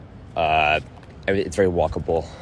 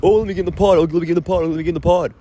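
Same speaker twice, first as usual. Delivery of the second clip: mocking a pushy guest, pitched up; whiny guest voice; 10 semitones higher, 4 dB louder, much faster than usual. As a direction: whine like someone begging. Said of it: "It's, it's".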